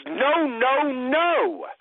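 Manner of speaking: each no pressed harder; rising emphatic no. Shown as a demonstration. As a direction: refuse firmly, no after no. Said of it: "most emphatic no known".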